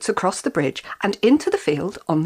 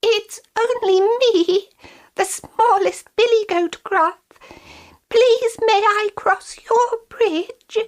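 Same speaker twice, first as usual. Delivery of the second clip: very high, timid little goat; tiny goat voice; 14 semitones higher than usual. A narrator's reading. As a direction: squeak it, nervous and small. Smallest goat pleading.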